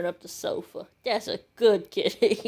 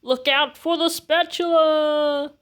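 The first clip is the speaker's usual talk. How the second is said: higher, louder heroic warning shout; battle cry; 7 semitones higher, 6 dB louder than usual. Acted. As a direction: yell it like a rallying cry. New battle cry, first take.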